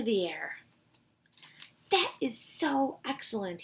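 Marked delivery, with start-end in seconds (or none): 1.9-3.6 excited cry. Acted. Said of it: "he cried".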